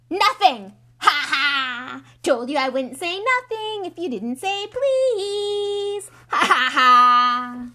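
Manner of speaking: high, very loud jeering singsong; gleeful shrieking taunt, drawn out; gloating poltergeist. Acted. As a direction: crow in triumph with mocking laughter. Peeves gloats over tricking Filch.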